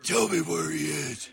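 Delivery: slow interrogating Batman growl; low gravel vigilante growl. Pretend. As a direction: demand it like a caped vigilante.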